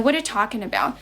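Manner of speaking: "What are you talking about" all run together; "What are you" shrunk to "whaddaya", stress on "talking"; native-style linking. Shown as a demonstration. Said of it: "就是他们会这么去说".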